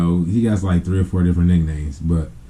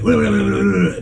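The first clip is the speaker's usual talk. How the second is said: rapid mock gibberish rant; unintelligible angry babble; 9 semitones higher than usual. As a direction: babble like a furious uncle nobody understands. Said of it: "You can't understand nothing he says".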